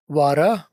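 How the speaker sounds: "वारा" with a tapped R and open ending; no T and no final R in "वारा". Shown as a demonstration. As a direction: say water as "वारा". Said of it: "टी को आर कर दिया".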